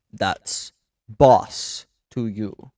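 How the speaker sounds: very slow, word-by-word rebuke; slow, emphatic bossy put-down; stern correction. Acted. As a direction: space out each word, stressing "boss". Boss corrects the underling's address.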